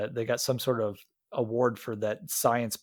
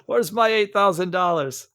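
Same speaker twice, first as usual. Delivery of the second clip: higher, louder demand; angry shout; 7 semitones higher, 6 dB louder than usual. Retold or imitated. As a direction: shout about the lost money. Joins in the movie yelling bit.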